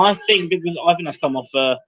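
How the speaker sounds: normal talking voice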